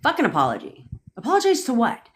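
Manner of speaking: usual speaking voice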